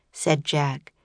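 plain reading voice

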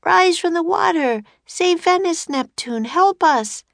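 high, loud plea into the storm; Annie shouting. A narrator's reading. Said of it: "shouted Annie".

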